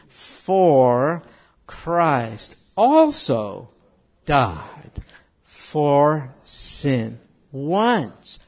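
slow, loud, one word at a time; staccato. Shown as a demonstration. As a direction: recite word by word with pauses. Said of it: "We'll go one word at a time".

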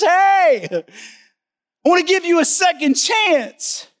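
God calling out kindly to Moses; God speaking. Acted. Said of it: "God comes to him ... and says".

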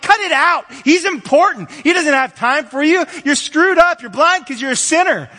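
very high, loud scolding; crowd rebuking. Acted. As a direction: shout him down with contempt. Crowd rebukes the blind man.

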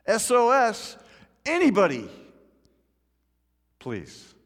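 loud high SOS fading to faint please; desperate cry. Acted. Preacher voicing Israelites calling for help, fading. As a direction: call for rescue then give up.

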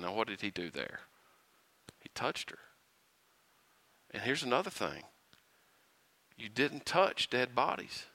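hushed voice for a secret detail. Real, not acted. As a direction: lean in and say it under your breath. Hushed explanation.

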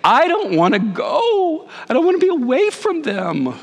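imitating crying. Young father leaving his wife and baby, mock crying high. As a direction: ham up a teary plea. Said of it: "I am crying and saying".

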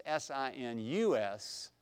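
S I N U S spelled letter by letter, slowly; spelling it out. Shown as a demonstration. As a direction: spell out sinusoidal for the class, letter by letter. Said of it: "that's what goes in the second blank".

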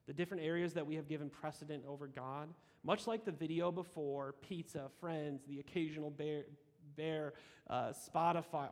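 usual speaking voice

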